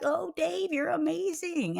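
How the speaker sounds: high, sing-song fawning; gushing admirers. Retold or imitated. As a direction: imitate over-the-top praise.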